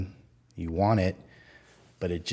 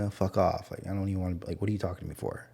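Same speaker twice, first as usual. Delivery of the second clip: ordinary talk, nothing marked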